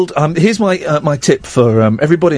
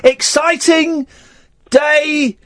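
slow, high hammed-up announcement; mock excitement; 10 semitones higher, slower than usual. Host hyping the day to co-host, mock-excited slow build-up. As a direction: draw out each word high, as if heralding big news.

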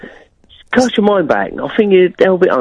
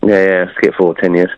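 ordinary delivery

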